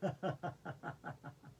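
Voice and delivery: rolling exercise laugh; laughing cobra. Shown as a demonstration. Yoga teacher coming up as a laughing little cobra, deliberate laugh. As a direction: laugh in short, even pulses. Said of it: "Laughing little cobra".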